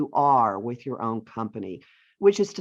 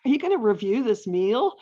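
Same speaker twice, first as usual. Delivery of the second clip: higher, nervous host asking with a laugh; nervous home cook; 6 semitones higher than usual. Retold or imitated. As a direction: play the anxious host. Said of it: "Home cooks asking".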